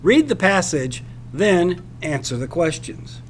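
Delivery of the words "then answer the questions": ordinary delivery, nothing marked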